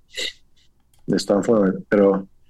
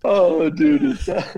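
trying not to laugh; 8 semitones higher than usual. Real, not acted. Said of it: "No puedo dejar de reír".

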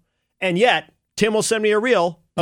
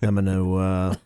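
lazy low hotshot pilot; airline pilot voice; 7 semitones lower than usual. Acted. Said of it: "You did the pilot voice".

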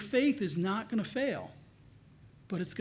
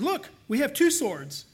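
eager disciples; 7 semitones higher, 6 dB louder than usual. Acted. Disciples' eager reply in reading.

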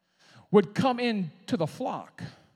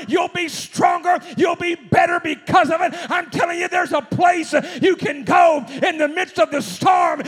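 shouted, high, loud preacher's crescendo; preaching at full force; 10 semitones higher, 10 dB louder than usual. Acted. Preacher to congregation, a full-force shouted promise. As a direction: lift the church with a roaring promise.

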